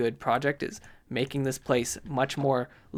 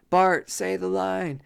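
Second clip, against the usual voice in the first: higher, drawn-out put-on voice; slow, pitched-up demand; eager prompting voice; 5 semitones higher, 3 dB louder, slower than usual. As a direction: egg someone on to perform. Acted demand to a cartoon character.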